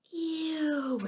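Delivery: high cry of disgust; disgusted scream. A narrator's reading. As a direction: squeal in disgust. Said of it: "everyone screamed".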